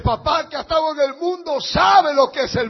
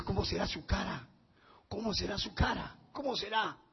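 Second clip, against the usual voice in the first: a quiet, softer wondering; hushed wonder; 3 semitones lower, 13 dB quieter than usual. Retold or imitated. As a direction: murmur it to yourself, hushed.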